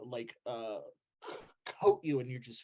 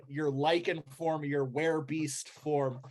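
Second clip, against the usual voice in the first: normal talking voice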